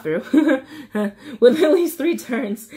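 real chuckle breaking into the words; amused laughing. Real, not acted. Reader recovering from a slip in the instructions, amused laughing. Laughs after stumbling while reading.

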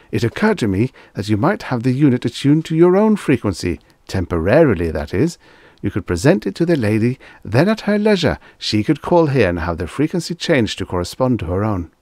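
high, swooping, hopeful persuasion; eager salesman. Acted. Clerk suggesting a temporary attunement to customer, eagerly persuasive. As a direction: coax with lively leaps in pitch.

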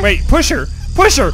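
loud urgent call; urgent shout. Real, not acted. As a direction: shout it at your teammate. Urgent game instruction.

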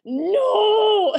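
high, loud, pained no; a teacher at the end of his patience; exasperated no. Retold or imitated. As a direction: cry out no in frustration. Teacher correcting her pronunciation.

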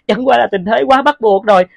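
usual speaking voice

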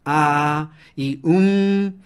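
slow hesitation noises; drawn-out filler sounds. Shown as a demonstration. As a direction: stretch each filler out. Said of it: "sonidos como el e".

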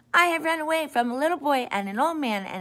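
fast, high, loud boasting shout. A narrator's reading. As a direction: yell the boast right in his face. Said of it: "Then he shouted".

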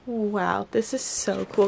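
ordinary talk, nothing marked

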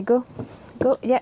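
ordinary delivery

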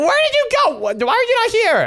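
high-pitched, incredulous demand; baffled outburst. Pretend. Seeker finds Cam's spot empty, baffled outburst. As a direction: shoot the pitch way up in disbelief.

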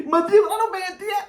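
high put-on pleading with laughter; mocking imitation. Reactor imitates the man's appeal to Maddi, mocking imitation. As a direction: squeak out a mock appeal. Mimics the clip's request, laughing.

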